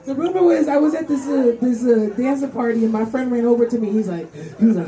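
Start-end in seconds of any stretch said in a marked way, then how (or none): none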